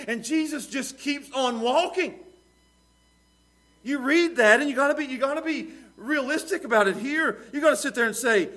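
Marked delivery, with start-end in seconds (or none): none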